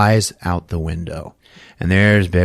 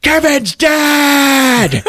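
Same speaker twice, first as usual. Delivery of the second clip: very high, loud drawn-out wail; mock anguished shriek; hammed-up scream; 6 dB louder, much slower than usual. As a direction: scream it out long and high, comically desperate. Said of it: "he just screams out".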